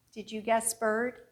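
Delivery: usual speaking voice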